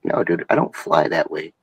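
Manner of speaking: normal talking voice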